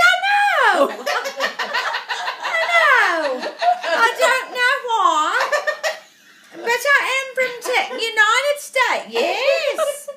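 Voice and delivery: very high, loud mock English wail; mimicked English accent. Confessing to the English lady, very high loud English accent. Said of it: "we're talking like this the whole time".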